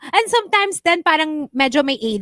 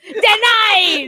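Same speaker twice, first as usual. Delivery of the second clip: loud verdict; 7 semitones higher, 8 dB louder than usual. Pretend. Mock visa officer verdict.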